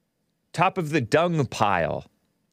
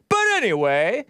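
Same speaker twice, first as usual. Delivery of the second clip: high, slow, stretched-out announcer call; drawn-out show kickoff; 11 semitones higher, much slower than usual. Real, not acted. Host kicking off his live show, drawn-out hype. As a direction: launch the show, dragging out every word up high.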